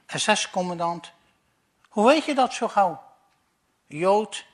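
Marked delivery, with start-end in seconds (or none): 1.9-3.0 surprised. Acted. SS commander surprised asks.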